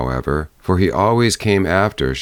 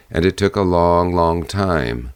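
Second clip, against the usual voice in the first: ordinary delivery, nothing marked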